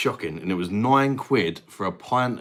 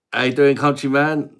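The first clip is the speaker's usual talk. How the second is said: put-on higher, louder Indian-accent greeting; [name]'s Indian accent; 6 semitones higher, 7 dB louder than usual. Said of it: "That's just [name] doing his day job".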